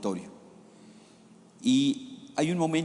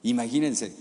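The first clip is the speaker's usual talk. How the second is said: usual speaking voice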